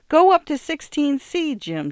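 high, loud frantic cry for help; wife screaming at husband to stop a beating; panicked scream. A narrator's reading. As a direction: scream at him to go upstairs now. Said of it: "she screamed".

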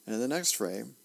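usual speaking voice

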